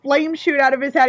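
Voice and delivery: ordinary talk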